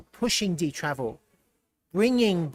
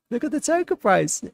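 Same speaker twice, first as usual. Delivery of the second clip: high-pitched, squeaky voice through laughter; laughing disbelief; 8 semitones higher than usual. Real, not acted. Streamer points viewers to the token price, laughing in disbelief. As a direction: squeal it while cracking up.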